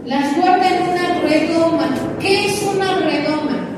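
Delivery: fervent sermon climax; preaching at full force. Acted. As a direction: proclaim it to the congregation.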